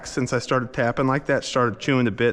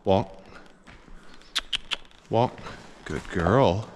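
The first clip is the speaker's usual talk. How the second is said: usual speaking voice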